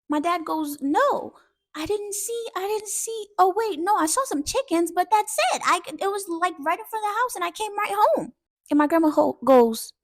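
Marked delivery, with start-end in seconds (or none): 0.8-8.3 put-on high, childlike protest; flustered denial. Dad telling grandma about the chickens, flustered. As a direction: imitate a kid scrambling to recall and explain.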